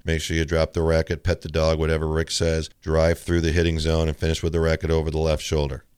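low monotone tennis instruction; dry, low-pitched coach demo; dull instructor. Shown as a demonstration. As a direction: recite the technique evenly, without any excitement. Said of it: "let's start with this example".

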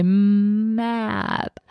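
word stretched as slow as possible; child saying a word as slow as they can. Shown as a demonstration. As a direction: drag every sound of the word out. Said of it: "say it as slow as you can".

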